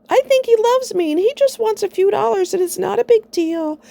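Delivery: high airy mock voice of a smitten fool; mock gullible woman. To the friend, mocking what she never said, high and silly. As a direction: play a lovesick dupe, high and silly. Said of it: "I didn't talk stupid".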